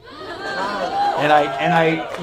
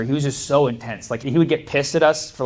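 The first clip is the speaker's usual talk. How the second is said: normal talking voice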